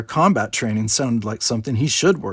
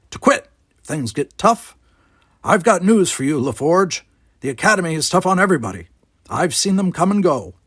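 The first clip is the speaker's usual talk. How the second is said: put-on grumpy old man; 7 semitones higher, 5 dB louder than usual. Gardener lecturing.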